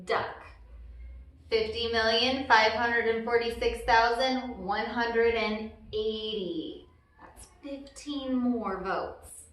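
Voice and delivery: plain reading voice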